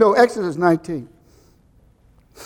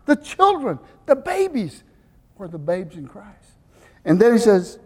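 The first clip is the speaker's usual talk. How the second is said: ordinary delivery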